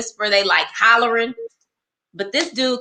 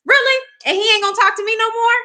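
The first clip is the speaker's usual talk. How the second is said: indignant disbelief; 11 semitones higher, faster than usual. Retold or imitated.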